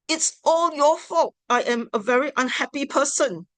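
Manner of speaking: a hammed-up accusatory complaint; accusing. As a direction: nag and blame with absolutes. Example of blaming, accusatory words.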